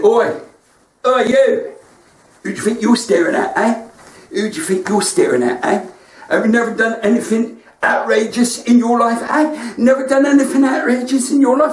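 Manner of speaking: imitating a rude teenager telling him off; aggressive teen. Teen at the counter confronting the comedian, mock aggressive. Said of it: "he got quite aggressive".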